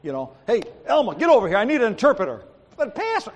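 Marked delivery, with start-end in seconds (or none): 0.5-2.4 loud, higher, hurried command; barking orders. Retold or imitated. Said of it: "I'm good at barking orders". 2.8-3.3 high-pitched imitated objection; mock protest. Elma protests the pastor's orders.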